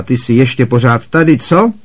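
sneering aunt. A narrator's reading. Aunt Marge sneering at Harry, loud and mocking. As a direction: sneer down at the boy.

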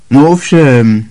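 Harry. A narrator's reading. Harry answers.

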